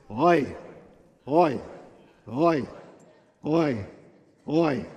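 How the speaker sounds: fuller, heartfelt groaned oi; oi with feeling. Shown as a demonstration. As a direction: groan each oi with feeling, evenly spaced. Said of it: "say it with feeling".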